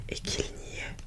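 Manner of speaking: plain reading voice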